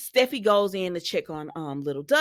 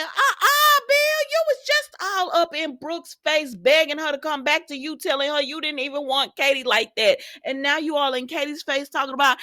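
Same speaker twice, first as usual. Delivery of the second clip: high-pitched mock telling-off; indignant scolding; 5 semitones higher than usual. Acted scolding of Bill.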